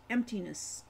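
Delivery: ordinary delivery, nothing marked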